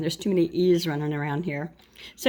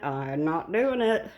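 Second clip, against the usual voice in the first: slow firm refusal; slower than usual. Real, not acted. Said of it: "and I'm not doing it".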